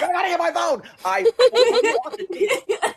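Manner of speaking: high, loud frantic cry; panicked rush. Retold or imitated. Telling friends about leaping off the massage table, high loud frantic cry. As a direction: scramble as if the phone is on fire.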